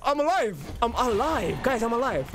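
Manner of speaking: real joyful yelling; elated exclaiming. Real, not acted. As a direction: cheer that you survived. Celebrating surviving the fight.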